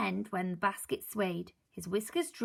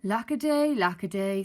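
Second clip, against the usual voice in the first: mock sadness; 7 dB louder than usual. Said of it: "said Mister Grinling sadly".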